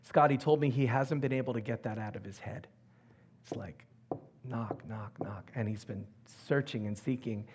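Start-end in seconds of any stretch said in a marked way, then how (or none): 4.5-5.5 very soft, whispered knocks; hushed knocking. Retold or imitated. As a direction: tap the words out under the breath. Imitates a thought knocking inside head.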